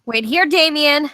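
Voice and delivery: loud, high call after him; Alice yelling after Damien as he runs out, loud; shout. Acted. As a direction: yell to stop him leaving.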